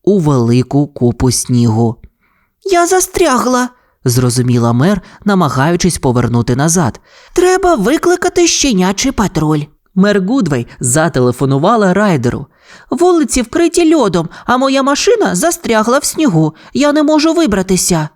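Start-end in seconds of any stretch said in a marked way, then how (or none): 2.6-3.7 very high character voice for the mayor; dismayed mayor. A narrator's reading. Mayor realises she is stuck, high dismayed voice. 7.3-9.6 high-pitched worried mayor voice; Гудвей. A narrator's reading. Mayor stuck in snow decides to call, high voice. 12.9-18.1 high, worried mayor on a call; Гудвей. A narrator's reading. Mayor phones Ryder for help, high worried voice.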